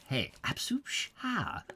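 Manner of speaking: a light hint of a Terry-Thomas cad; lightly voiced English cad; Terry-Thomas impression. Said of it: "not quite a Terry Thomas".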